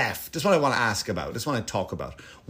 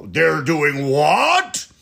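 put-on slow, louder, incredulous old-man squawk; outraged old conservative; 6 dB louder, much slower than usual. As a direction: play the shocked grumpy old guy. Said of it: "those old guys".

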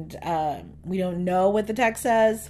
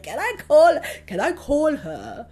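excited fanboy, pitch jumping up; Prince Harry; 6 semitones higher, 4 dB louder than usual. Retold or imitated. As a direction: plead eagerly like a starstruck fan. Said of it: "I loved how excited Prince Harry was".

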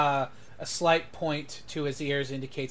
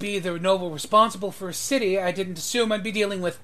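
higher, rushed protest bursting on the last word; exasperated noble; 5 semitones higher, 7 dB louder, faster than usual. Acted. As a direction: complain quickly, then blurt the last word loudly. Overwhelmed noble points at rampaging monster.

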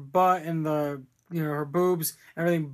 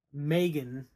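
ordinary talk, nothing marked

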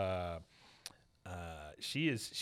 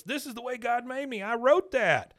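much higher, louder protest; indignant Peggy; 11 semitones higher, 6 dB louder than usual. Retold or imitated. Peggy protesting to Hank at the exit, high indignant insistence. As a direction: insist shrilly.

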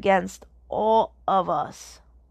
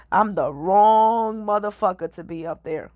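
said through laughter; laughing; 4 dB louder than usual. Real, not acted. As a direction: laugh as you say it. Laughing at the idea of fame.

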